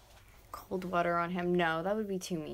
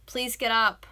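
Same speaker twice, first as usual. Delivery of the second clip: loud, higher plea; calling out; 4 semitones higher, 9 dB louder, slower than usual. Real, not acted. Begging boyfriend to get up, calling out. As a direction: urge him up insistently.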